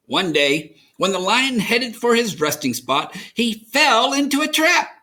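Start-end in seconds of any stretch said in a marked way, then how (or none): none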